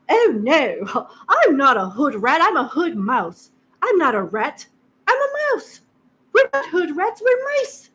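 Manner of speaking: high, prissy put-on posh voice; mock posh voice rising to a squeak; mock posh accent. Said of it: "I don't know where the accent come from".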